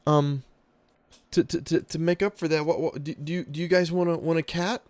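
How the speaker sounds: put-on high, stammering kid's voice; nervous boy. Boy thanking the player for the gold, high stammering kid. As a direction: stumble over every word like a shy boy.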